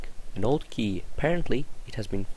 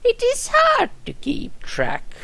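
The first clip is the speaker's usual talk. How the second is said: put-on much higher, slow, weary old nurse; retake; 3 dB louder, slower than usual. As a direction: lean harder into the high frail voice. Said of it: "Let's try again".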